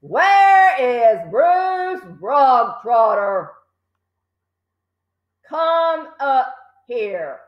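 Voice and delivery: shout. A narrator's reading. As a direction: summon him like a tyrant. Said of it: "the Trunchbull shouted".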